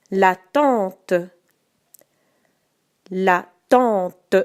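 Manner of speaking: slow, carefully articulated dictation. Shown as a demonstration. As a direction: say each word clearly for spelling. Dictating each word twice.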